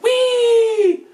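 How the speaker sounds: a high, loud giddy squeal; gleeful whee. Retold or imitated. Shambling mound healed by lightning, squealing with delight. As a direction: squeal with delight like a carefree monster.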